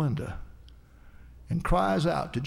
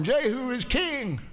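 mock holler, higher and drawn out; retold hollering; 6 semitones higher than usual. Crowd proclaims their new king, retold hollering. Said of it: "and hollering".

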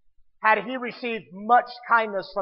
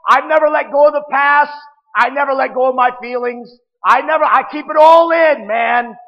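loud, higher, defiant self-justifying rant; angry arguer; 3 semitones higher, 9 dB louder than usual. Retold or imitated. Preacher voicing an angry arguer, loud defiant rant. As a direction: play the angry man insisting he was right. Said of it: "So he's an arguer".

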